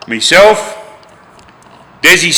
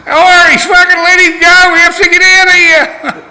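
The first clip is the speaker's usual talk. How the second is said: loud, high urgent yell broken by laughter; laughing shout; 5 dB louder than usual. Retold or imitated. Urging Irish to release the bikie before cops come, loud and high. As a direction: shout at him to let go, half laughing.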